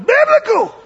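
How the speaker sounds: BIBLICAL yelled high and loud; high, loud imitated preacher yell; gospel preacher cry. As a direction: shout "Biblical" with pulpit zeal. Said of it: "we don't even know how to say it".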